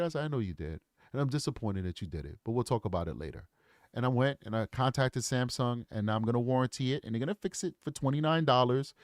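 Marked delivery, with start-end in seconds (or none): 0.2-3.5 low, soft, measured disappointment; calm disappointed father. Retold or imitated. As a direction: quietly let the son know you're let down. Father scolding son, calm.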